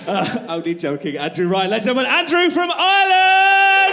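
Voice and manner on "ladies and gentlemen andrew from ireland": booming host welcome; announcer shout. Acted. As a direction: sell the name to the crowd. Host introduces a contestant.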